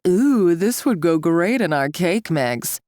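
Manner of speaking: slow, sneering mock of their naive delight; a lightly put-on voice, scornful; mocking mimic. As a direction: make their idea sound stupid. Mocking the fools' thinking.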